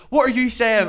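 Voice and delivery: high, loud confrontational demand; retold heated challenge; acted angry comeback.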